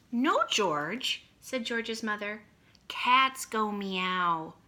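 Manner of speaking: slow, lively picture-book reading; reading to children. A narrator's reading. Teacher reading a picture book to her class, slow and lively. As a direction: read it out big for kindergartners.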